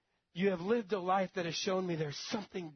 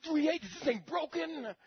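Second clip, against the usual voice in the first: high, incredulous squeak; disbelief; 9 semitones higher than usual. Retold or imitated. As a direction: sound like the clock must be broken. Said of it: "and you're like".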